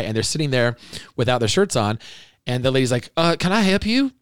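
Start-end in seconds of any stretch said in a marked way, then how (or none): 3.2-4.1 put-on much higher, botched New Zealand accent; a clumsy try at New Zealand speech; bad New Zealand accent. A host mimicking a New Zealand porn star badly in a New Zealand accent. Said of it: "That's a really bad New Zealand accent".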